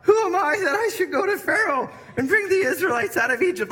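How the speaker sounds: imitating Moses, scared and cracking. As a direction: play Moses losing his nerve, voice cracking. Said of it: "you can almost hear his voice cracking".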